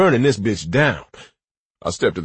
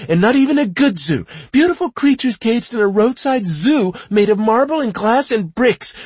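rising furious rant, higher and louder; 11 semitones higher, 6 dB louder than usual. Acted. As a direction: build the rant to a shout.

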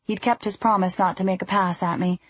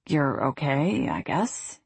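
slow, softer, fumbling and hesitant; stumbling over words; 5 semitones lower, 5 dB quieter, slower than usual. A narrator's reading. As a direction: trip over the words, embarrassed. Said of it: "stumbling over his words".

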